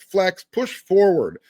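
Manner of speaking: usual speaking voice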